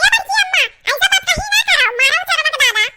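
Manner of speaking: put-on a little boy yelling to beat the thief; excited small boy. Boy yells to his grandmother to beat the thief, high excited shouting.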